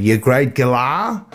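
slow, drawn-out mock Aussie accent. Brit abroad constantly teasing the locals, put-on Aussie accent. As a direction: stretch it out in a hammed-up Aussie accent. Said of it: "proud of my Aussie accent".

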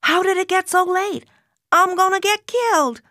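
put-on high, panicky teen voice; alarmed. A narrator's reading. Teen girl panics to friends about lateness, high and alarmed. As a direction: panic at being out so late. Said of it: "Alarmed voices joined mine".

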